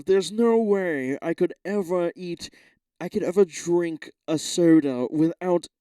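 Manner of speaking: lightly voiced prissy podcast guest; mock someone being precious about ice; a hint of a fussy podcaster; mocking podcast guy. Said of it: "someone was like".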